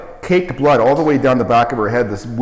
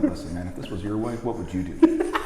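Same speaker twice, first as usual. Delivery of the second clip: hushed aside; 3 semitones lower, 6 dB quieter than usual. Retold or imitated. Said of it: "And I'm like".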